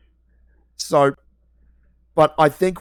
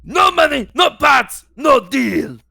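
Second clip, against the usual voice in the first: put-on high shouted Watto accent; 9 semitones higher, slower than usual. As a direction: bark it like a greedy junk dealer. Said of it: "Oh, you mean Watto?".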